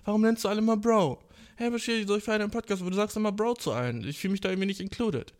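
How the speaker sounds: imitating women. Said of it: "von Frauen gehört".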